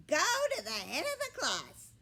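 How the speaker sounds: high, loud, sharp snap with a laugh; snapped. A narrator's reading. Gabby the bird sarcastically snapping at Millie, high loud snap. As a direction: snap it out mockingly. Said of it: "snapped Gabby".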